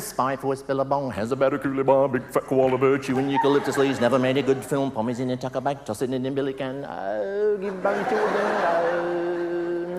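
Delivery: mock Australian sing-song nonsense; Australian gibberish. Comic spoofing an Australian entertainer with mock Australian nonsense. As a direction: ham up an Aussie entertainer. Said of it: "Here's my Phil Cool, see what you think".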